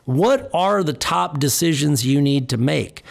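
normal talking voice

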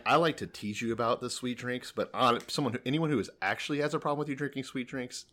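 ordinary talk

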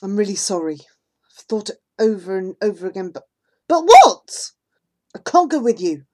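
plain reading voice

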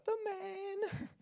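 very high, drawn-out mock fanfare; hammed-up billing for a big shot; mock hero. Preacher teasing Peter as the man, mock admiring.

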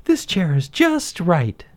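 put-on high, slow storybook girl, pleased; high, slow, happy character voice; smiling, contented. A narrator's reading. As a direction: smile through it as a satisfied Goldilocks. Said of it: "she said with a smile".

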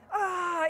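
high, loud "ah" cry; imitated yell. Retelling friend's exit from prayer meeting, mock high yell. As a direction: let out Rob's high cry as he bolts.

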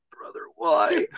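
high falsetto, hammed-up pleading; retelling a crying brother, high and hammed-up; mock crying plea. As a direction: whimper it in a high mock wail. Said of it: "he's crying and pleading with him".

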